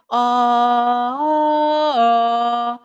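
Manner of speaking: long voiced "aah" sliding up in pitch; drawn-out "aah". Shown as a demonstration. Teacher showing vocal-cord flexibility exercise, drawn-out "aah". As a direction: sustain an open "aah" as a vocal glide. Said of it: "حرف آ رو با صدا بکش".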